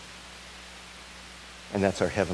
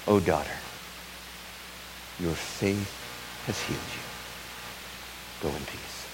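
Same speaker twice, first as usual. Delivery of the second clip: tender blessing; 8 dB quieter than usual. Acted. Jesus blessing his daughter, softening to a hush. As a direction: fade into a gentle hush.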